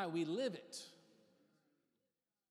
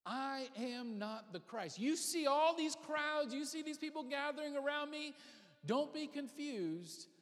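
John the Baptist; 5 semitones higher than usual. Retold or imitated. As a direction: address a crowd, drawing the words out. Acting John addressing the gathered crowds.

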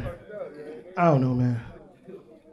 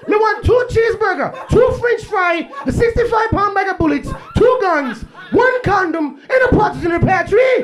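put-on Jamaican accent; 7 semitones higher than usual. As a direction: demand your order like an angry Jamaican customer. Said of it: "Jamaicans think McDonald's".

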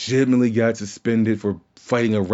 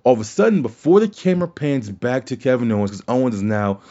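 ordinary delivery

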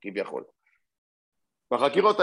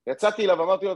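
normal talking voice

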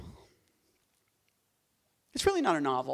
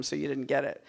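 ordinary talk

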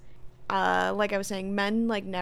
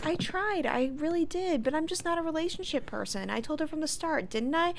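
higher-pitched put-on protest; pleading, hammed-up self-defence; Samantha; 8 semitones higher than usual. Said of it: "Samantha goes".

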